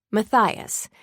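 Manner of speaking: usual speaking voice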